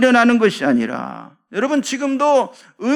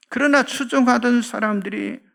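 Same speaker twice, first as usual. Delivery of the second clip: normal talking voice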